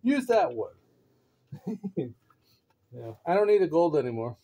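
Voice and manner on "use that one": higher, louder delighted inner voice; retold excitement, bright and eager; excited. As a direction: gush like you just found a treat. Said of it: "I'm like".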